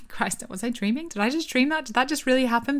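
high-pitched, disbelieving self-questioning; retold freaking out. Woman on bed doubting herself, freaking out. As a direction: re-enact doubting your own senses, rising in pitch. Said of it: "just freaking out".

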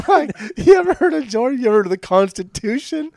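high, loud mocking voice of a Trump supporter. Podcast host mocking a Trump supporter, high and loud. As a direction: sneer it out as a smug Trump fan. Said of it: "somebody who supports Donald Trump would say".

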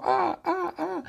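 very high, repeated squawks; bird cries. Acted. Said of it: "Ella vuela como un pájaro". (graznidos agudos de pájaro)

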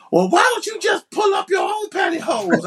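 high-pitched mock scolding from somebody off-mic; overheard background voice. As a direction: imitate someone's high, exasperated outburst. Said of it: "all you hear is somebody going".

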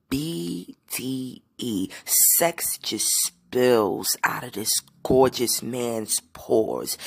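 slow, hushed, breathy swoon; sultry swooning. Real, not acted. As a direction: let it melt into a lustful near-whisper. Swooning over a rapper she fancies.

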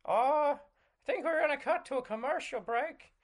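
flustered host escaping to an ad; Jordan Peterson. Acted. Host cutting away after guest's offer, high and flustered. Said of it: "Jordan Peterson in your".

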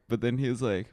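ordinary delivery

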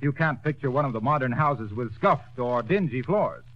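normal talking voice

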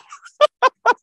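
loud, high burst of laughter; big laugh. Real, not acted. Laughing at the clerk's mix-up, a loud high cackle. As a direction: crack up hard at the punchline.

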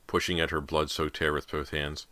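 ordinary delivery, nothing marked

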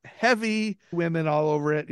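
normal talking voice

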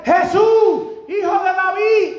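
preacher voicing blind Bartimaeus calling to Jesus, shouting; blind man crying out. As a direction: cry out over a crowd. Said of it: "empieza a gritar".

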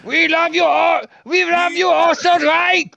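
high, loud squealing toy voice; German Furby toy. Pretend. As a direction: shriek it like a German talking toy. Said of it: "original Furbies were called Furbskins".

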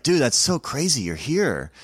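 a hint of an oblivious, starstruck chef. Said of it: "he still didn't really understand".

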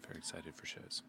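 breathy whispered excitement. Shown as a demonstration. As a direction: whisper it like a secret.

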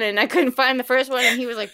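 normal talking voice

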